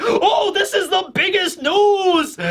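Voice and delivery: high, slow, thrilled exclamation; excited shout. Retold or imitated. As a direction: gush over the big scoop. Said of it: "being like".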